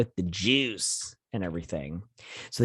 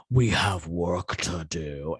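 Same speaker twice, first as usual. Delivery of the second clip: slow, ominous villain line; menacing villain; 3 semitones lower, much slower than usual. Retold or imitated. Villain father greeting his son, slow and menacing. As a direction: drag it out like a cold villain.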